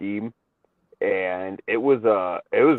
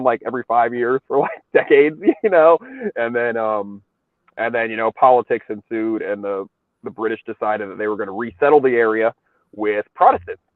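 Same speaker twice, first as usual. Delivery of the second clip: usual speaking voice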